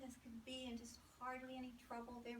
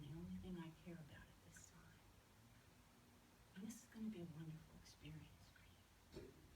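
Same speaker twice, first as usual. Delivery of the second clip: gentle whisper; 4 semitones lower, 8 dB quieter than usual. Pretend. Doctor apologizes gently to the baby.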